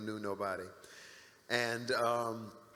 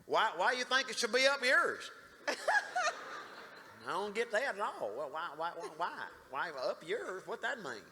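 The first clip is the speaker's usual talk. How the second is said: high, loud imitation of a Southern man, laughing through it; doing the Southern boss in disbelief; Southern accent; 10 semitones higher, 3 dB louder than usual. Imitating the Southern guy John asking why, in a high Southern voice. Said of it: "He was super Southern".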